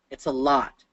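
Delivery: ordinary delivery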